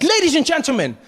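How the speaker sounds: high, hyped-up show host; excited announcer. Acted. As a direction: whip up the crowd as an emcee. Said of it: "[name]'s really excited".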